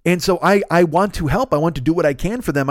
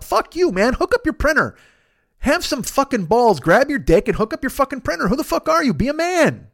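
高八度地自我痛骂，像个严厉的内心声音; 尖着嗓子冲自己吼的那种恨铁不成钢; mock inner critic; 8 semitones higher than usual. Inner critic berating himself.

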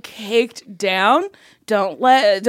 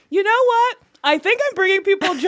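high proud voice; 9 semitones higher than usual. Retold or imitated. Self-mocking confident inner voice.

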